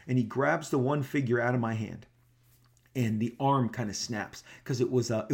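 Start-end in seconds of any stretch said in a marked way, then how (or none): none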